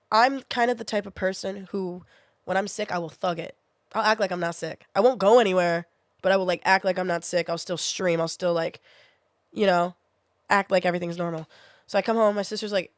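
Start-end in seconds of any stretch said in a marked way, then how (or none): none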